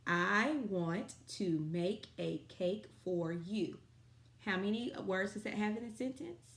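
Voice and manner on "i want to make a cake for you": slow, even, louder dictation. Shown as a demonstration. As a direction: repeat the sentence slowly and loudly. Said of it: "sight word sentence of the day".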